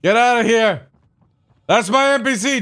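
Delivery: high loud shout; territorial yell. Acted. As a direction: bark at the intruder. Protecting his NPC.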